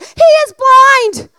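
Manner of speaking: high, loud, pleading he is blind; half-shouting in disbelief at the crowd; exasperated outburst. Retold or imitated. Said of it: "I'm like".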